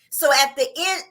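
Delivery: normal talking voice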